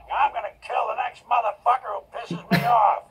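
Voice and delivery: angry shout. Acted. Duncan did mind, angry line.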